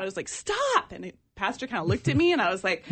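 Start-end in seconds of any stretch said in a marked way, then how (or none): 0.3-0.9 sudden high cry of stop; retold scream. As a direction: blurt it out high and urgent. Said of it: "screamed out".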